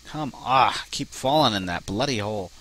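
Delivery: ordinary delivery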